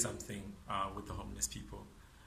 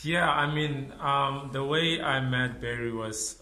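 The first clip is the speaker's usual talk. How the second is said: loud; 5 semitones higher, 10 dB louder, slower than usual. Real, not acted. Mask off, loud close to camera.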